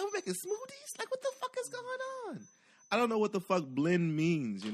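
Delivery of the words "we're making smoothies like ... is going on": high, baffled falsetto; very high, confused voice; high confused squeak. Retold or imitated. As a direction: squeak it out like a lost kid. Acting out his confused self.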